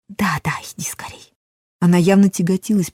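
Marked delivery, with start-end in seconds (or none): 0.1-1.3 hurried urgent whisper. A narrator's reading. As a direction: whisper it, shooing him out. Hurrying lover out secretly.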